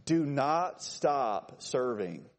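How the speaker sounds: very slow "Do not stop serving", each word separate; emphatic repetition. Shown as a demonstration. Repeats it more emphatically.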